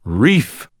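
put-on high-pitched drunken call; tipsy lookout's cry; drunken slur. A narrator's reading. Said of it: "I slurred drunkenly".

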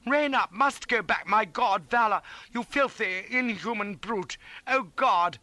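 high, anguished, broken outburst; desperate bawling. A narrator's reading. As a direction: bawl in horror and outrage. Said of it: "he bawled".

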